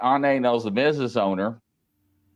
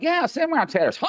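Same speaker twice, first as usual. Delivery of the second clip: excited retold reply; 11 semitones higher than usual. Retells own reply at salon.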